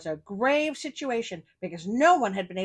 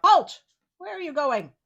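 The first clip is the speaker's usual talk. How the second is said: sharp loud halt, then a raised question; sentry calling out; 6 semitones higher, 8 dB louder than usual. Acted. Sentry stopping a cat at the castle gate, loud call. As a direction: bark out the challenge like a gate guard. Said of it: "A sentry called out".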